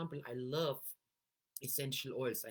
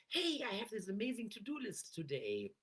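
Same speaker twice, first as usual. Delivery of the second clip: higher, perky and upbeat; chipper voice; 7 semitones higher than usual. Shown as a demonstration. Coach demoing upbeat self-talk about a to-do list. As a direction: sound thrilled about the day. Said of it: "get into that chipper voice tone".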